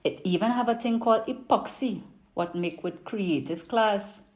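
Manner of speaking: put-on lively Trini market vendor accent; Trinidadian accent. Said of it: "I'm gonna do it in my best Trini market accent".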